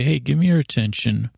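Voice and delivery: ordinary delivery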